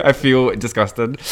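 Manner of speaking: slow, groggy hangover voice; hungover. Real, not acted. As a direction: sound rough and hungover. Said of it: "I'm so hungover right now".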